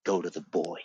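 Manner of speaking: low, softened voice of quiet revelation; gently imitating a whispered instruction; retold whisper. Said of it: "God whispered to me and said".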